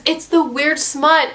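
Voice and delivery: normal talking voice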